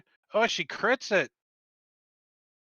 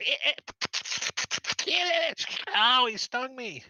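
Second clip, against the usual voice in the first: squealing creature; 10 semitones higher than usual. Acted.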